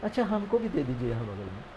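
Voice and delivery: fast, soft, secretive aside; hushed whisper. Retold or imitated. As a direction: sneak the request in a hush so the neighbour won't hear. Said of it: "चुपचाप फुसफुसा के". (चुपके से धीमी फुसफुसाहट में)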